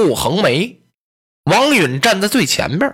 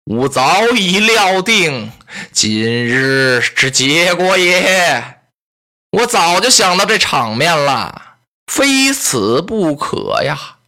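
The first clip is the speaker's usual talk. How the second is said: slow, drawn-out resignation; a doomed man accepting his end; resigned strategist; slower than usual. Acted. Captured Li Ru admits he foresaw his death, slow and resigned.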